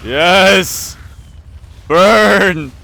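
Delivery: loud, high villain whoop; triumphant shout. Acted. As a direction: roar it out in triumph. Triumphant over erupting volcano.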